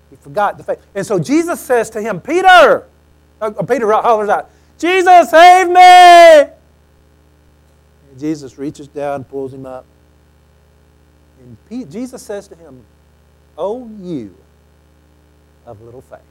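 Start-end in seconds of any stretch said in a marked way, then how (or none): 2.2-2.9 loud high call of the name. Acted. Preacher voicing a call in the storm, loud. Peter calling out while sinking. 4.8-6.5 loud high cry, save me; desperate cry for help. Acted. Peter sinking in the waves, crying out loud. 13.6-16.2 softer, fading rebuke; gentle rebuke. Acted. Jesus lifts Peter out and gently rebukes him.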